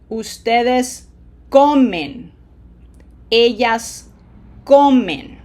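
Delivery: loud stress on "co" in "comen"; stressed syllable. Shown as a demonstration. Teacher has students repeat after her, stressing "co" in "comen". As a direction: punch the first syllable of "comen", pause before it. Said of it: "you are putting pronunciation emphasis there".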